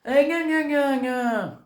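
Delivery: mock her mother in a singsong whine; teen mimicking her nagging mother; mocking gna-gna. Daughter mocks again.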